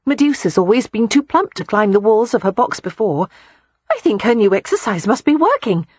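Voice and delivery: put-on bright young woman. A narrator's reading. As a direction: toss it off brightly. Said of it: "adding brightly".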